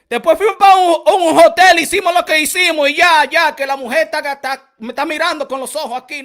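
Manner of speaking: real loud call at his partner; loud yell. Real, not acted. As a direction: shout it so she hears from the other room. Yelling toward his partner off-camera.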